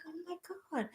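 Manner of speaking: high breathless "oh my God", then slowing; awed gasp. Pretend. Meditation guide voicing the listener's awe on arrival, gasping high. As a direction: swoon at the sight of the planet.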